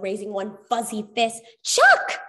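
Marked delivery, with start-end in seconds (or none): 1.6-2.2 "Chuck" yelled very high and loud; "Chuck" shouted high and loud to halt a fight; yelled name. Acted. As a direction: call "Chuck" sharply to stop him. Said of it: "the Dream Seeker yelled".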